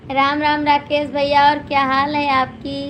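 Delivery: ordinary talk, nothing marked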